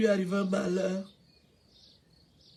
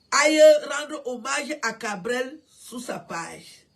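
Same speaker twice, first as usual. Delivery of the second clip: raised voice calling on her listeners, insistent; loud urging; 5 dB louder than usual. Real, not acted. A grieving mother calling on fans to honor Cabrel, loud and insistent. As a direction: she pleads with the crowd to honor him, voice up.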